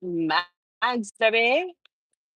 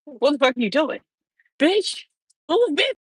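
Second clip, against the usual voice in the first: higher and higher, yelling at yourself to move; frantic self-scolding; 7 semitones higher than usual. Retold or imitated. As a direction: scold yourself like a drill sergeant. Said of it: "it's like I start freaking out".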